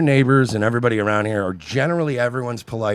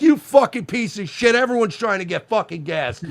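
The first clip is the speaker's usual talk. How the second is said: mock New York rage; 7 semitones higher than usual. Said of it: "you would have been like".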